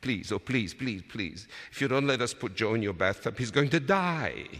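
put-on high, desperate child's begging; urgent plea climbing to a stretched last phrase. A narrator's reading. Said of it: "I begged".

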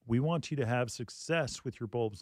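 usual speaking voice

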